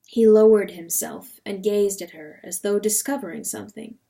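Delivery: ordinary delivery, nothing marked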